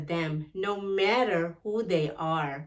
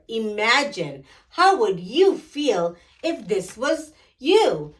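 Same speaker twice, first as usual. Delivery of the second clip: put-on bright, lively read-aloud for young kids; animated children's storybook reading; 3 semitones higher, 7 dB louder than usual. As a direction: tell it like a storybook to a class of children. Reads picture book to children.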